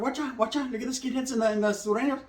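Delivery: high, hushed alarm; hushed high warning about a fight. Retold or imitated. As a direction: tip off the homies quietly. Warning friends across yard.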